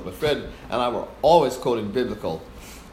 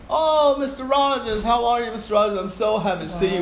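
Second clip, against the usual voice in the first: fawning conductor; 9 semitones higher than usual. Retold or imitated. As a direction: gush sweetly to win him over. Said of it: "he started fawning on me".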